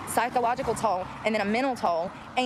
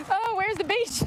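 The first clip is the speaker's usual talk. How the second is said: put-on whiny kid; 9 semitones higher than usual. Pretend dialogue in the car.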